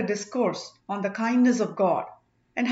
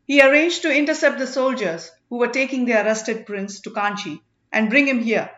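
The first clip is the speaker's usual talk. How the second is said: a lightly voiced eager informer, louder and raised; accusing witness; 4 semitones higher, 6 dB louder than usual. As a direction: denounce the impostor before everyone. Marapan testifies against Nilakesi.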